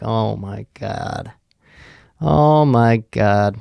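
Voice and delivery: long, drawn-out groaned words; retold dismay. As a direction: drag out each word in mock despair. Retold dismay at customer's plan.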